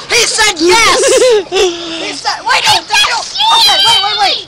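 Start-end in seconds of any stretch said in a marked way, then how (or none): 0.1-1.0 excited shout. Pretend. Excited about dog's answer. 2.4-4.4 frantic high shouts. Real, not acted. Sudden correction.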